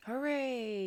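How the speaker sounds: a crowd's joyful hooray; crowd yelling. A narrator's reading. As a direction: cheer from the stands. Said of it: "yelled the crowd".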